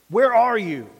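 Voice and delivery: loud, forceful stern call. Shown as a demonstration. God calling to hiding Adam, stern. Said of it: "Was it stern?".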